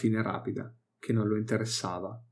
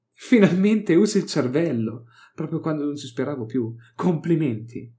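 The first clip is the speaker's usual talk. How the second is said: higher, louder, mocking approval; smug praise; 5 semitones higher, 4 dB louder than usual. Pleased archeoxenologist mockingly praises the Inquisitor. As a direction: pat him on the head with words. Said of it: "batté le mani e sorrise compiaciuto".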